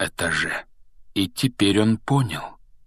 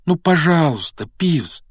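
high, louder entreaty; beg, leaning on the please; pleading; 8 semitones higher, 4 dB louder than usual. A narrator's reading. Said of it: "Гарри умоляюще сложил руки на груди". (умоляюще, высоким голосом)

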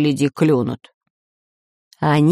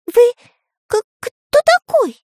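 very high, stuttering question; stammering; 4 dB louder than usual. A narrator's reading. Karandash asks the masked man who he is, stammering. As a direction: stammer and squeak it out. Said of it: "заикаясь, спросил Карандаш".

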